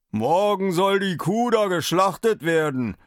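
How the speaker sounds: master of the house, voiced apart from the narrator; a hint of a gruff farmer; deep farmer voice. A narrator's reading. Farmer announces the cow's slaughter, gruff. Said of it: "eine tiefe Stimme".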